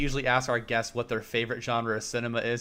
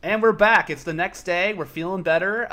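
louder, higher upbeat restart; bright comeback; 6 semitones higher, 6 dB louder than usual. Acted. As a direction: burst back in all cheerful. Cheerful restart next day.

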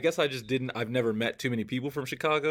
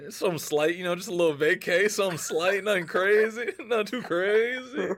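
higher, sing-song false modesty; mock-modest brag; 6 semitones higher than usual. Host teased about All-Star trip, mock-modest. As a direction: play it down coyly, stretching the last "crazy".

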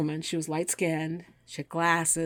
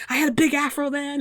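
quoting the guy; 9 semitones higher, 4 dB louder than usual. Retold or imitated. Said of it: "He was like".

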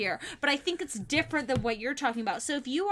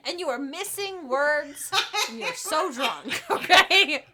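laughing, high and loud; giggling through the apology; 4 semitones higher, 5 dB louder than usual. Real, not acted. Cracking up at drunk friend.

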